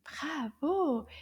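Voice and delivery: normal talking voice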